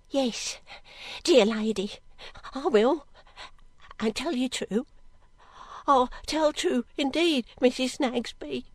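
put-on timid servant girl. Girl promising the lady she'll tell true, timid and earnest. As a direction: plead honesty in small, broken phrases.

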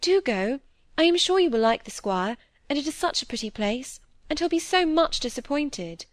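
high-pitched, eager coaxing; earnest, lifted entreaty; pleading. A narrator's reading. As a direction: plead on someone else's behalf. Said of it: "pleading with her eyes as well as her words".

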